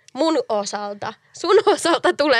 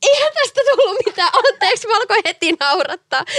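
laughing through apology; 7 semitones higher than usual. Real, not acted. Breaking character, laughing apologetically.

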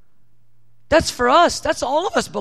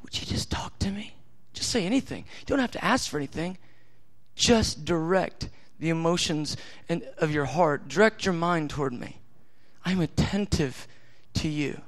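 softer, near whispered plea; voicing God, whispered; 4 semitones lower, 6 dB quieter than usual. God longing for the child to talk, whispered. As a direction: draw the listener in gently. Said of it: "He's going".

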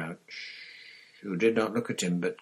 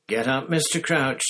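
loud, high, clear command; loudly and clearly; 6 semitones higher, 7 dB louder than usual. A narrator's reading. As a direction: speak loud and clear to reach him. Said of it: "said Harry loudly and clearly".

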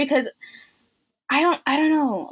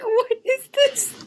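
high, slow, stretched-out disbelief; reacting to the film, high, slow and baffled; 11 semitones higher, much slower than usual. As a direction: drag it out, totally baffled. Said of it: "I was like".

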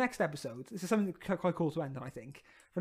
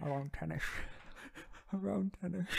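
lightly voiced Scottish accent, softer; slurred Scottish "ten-ish" punchline; understated Scottish film-star impression; Sean Connery; 9 dB quieter than usual. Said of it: "what time does, uh, Sean Connery".

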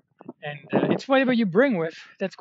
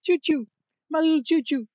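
put-on much higher, louder baby-talk coo; cutesy pet voice; 12 semitones higher, 5 dB louder than usual. Vlogger cooing over the adorable nickname, high baby talk. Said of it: "I think it's adorable".

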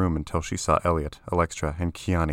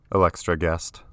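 usual speaking voice

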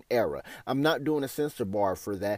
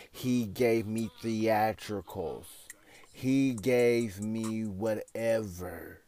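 slow emphatic; 6 semitones lower, 6 dB quieter, slower than usual. Real, not acted.

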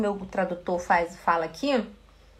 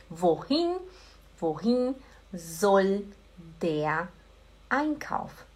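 slow, with a gap after each word; word-by-word model; 2 semitones lower, much slower than usual. Shown as a demonstration. German teacher modelling a question for students, slow and separated. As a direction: dictate it word by word, endings held.

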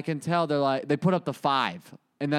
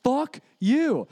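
angry call-out; 6 semitones higher, much slower than usual. Retold or imitated. Storming out to the person at the door, angry yell. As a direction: confront him hard.

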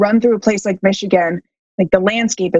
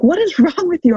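puzzled partner; 9 semitones higher than usual. Retold or imitated. Partner voiced in story.

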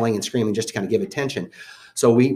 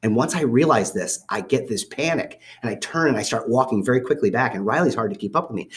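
normal talking voice

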